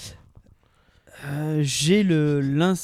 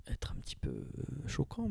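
soft, almost whispered aside; quiet; 12 dB quieter than usual. Real, not acted. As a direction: drop to a hush as if afraid to offend. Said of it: "Pourquoi tu le dis doucement?".

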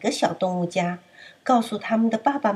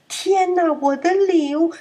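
dismayed cry; 9 semitones higher, 4 dB louder than usual. Acted. Lamb panics over gift.

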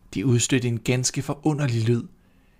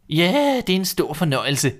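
high, bossy hen voice; a fussy hen nagging; hen's voice; 5 semitones higher, 3 dB louder than usual. A narrator's reading. Hen nagging the restless duckling, high and bossy. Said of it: "sagde hønen".

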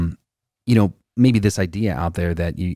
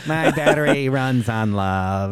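slow, laughing robot voice; a robot with a chuckle; 5 semitones higher, 3 dB louder than usual. Pretend. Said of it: "my robot voice".

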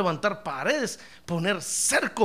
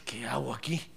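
hushed worry; 9 semitones lower, 10 dB quieter than usual. Retold or imitated. Pastor voices worried property owner.

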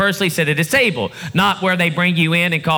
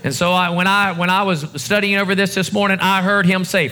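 usual speaking voice

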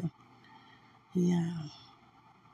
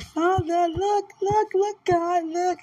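high, urgent cries to God; excited pleading; 11 semitones higher, slower than usual. Retold or imitated. Retelling her plea to God, high and eager. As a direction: call on God eagerly, voice jumping up. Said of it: "Now I'm like".

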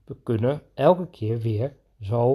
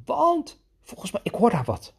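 much higher, louder make-believe fright; high, playful "watch out" in the game; mock alarm; 4 dB louder, slower than usual. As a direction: warn him of a pretend danger. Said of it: "ik kan natuurlijk wel zeggen".

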